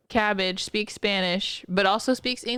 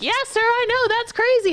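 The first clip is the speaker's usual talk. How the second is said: high chirpy nurse voice; 14 semitones higher, 4 dB louder than usual. Retold or imitated. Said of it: "Monty's just like".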